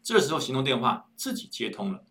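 ordinary delivery

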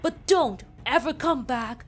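soldier urging her to run, loud and high; urgent shout. Acted. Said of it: "Then he said".

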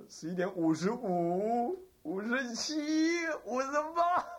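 drawn-out numbers climbing high; suspenseful countdown. Retold or imitated. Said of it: "倒数计时". (逐渐升高拖长的倒数)

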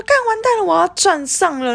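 panicked cry. Retold or imitated. About to crash on scooter.

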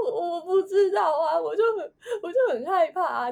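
high, tearful, loud voice breaking in sobs; retold crying. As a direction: replay the scared sob of that night. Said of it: "我就哭嘛".

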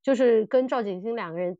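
usual speaking voice

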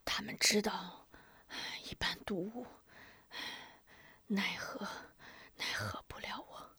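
faint broken whisper with coughs; dying whisper. A narrator's reading. Poisoned man whispering to the healer, dying. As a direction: push each word out with failing breath.

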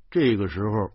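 usual speaking voice

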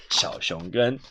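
usual speaking voice

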